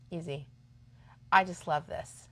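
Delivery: usual speaking voice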